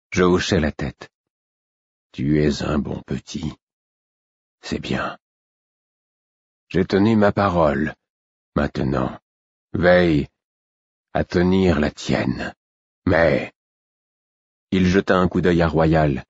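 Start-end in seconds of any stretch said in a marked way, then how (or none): 2.1-13.5 low, tired king, slow and grave; a weary king reminding the boy; frail old king. A narrator's reading. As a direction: press the promise with fading strength. King binds Fitz to his word.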